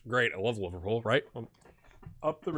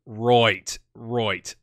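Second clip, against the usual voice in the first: low, drawn-out Aussie "roit"; Australian accent attempt; 4 semitones lower, 5 dB louder than usual. Trying out Australian accent just before.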